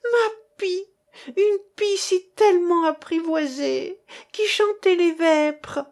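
very high, loud wailing cry; shrieking lament. A narrator's reading. Priest's cook wails over her magpie, crying loud. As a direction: cry out her loss to all.

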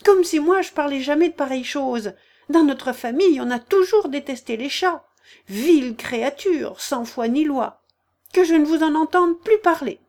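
high, loud furious tirade; the mouse ranting against cats, shaking. A narrator's reading. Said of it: "s'écria la souris en tremblant". (souris furieuse, voix aiguë et très forte)

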